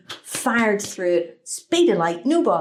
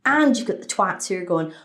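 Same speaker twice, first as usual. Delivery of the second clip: normal talking voice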